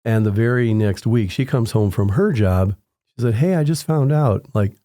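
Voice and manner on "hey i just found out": wife's excited news. Retold or imitated.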